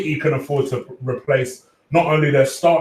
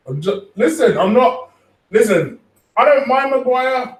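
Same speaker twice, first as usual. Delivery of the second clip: rising defensive protest, talking over the chat; high-pitched protest defending himself to chat; 10 semitones higher, 3 dB louder than usual. Real, not acted. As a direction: pitch jumps high and louder, insisting.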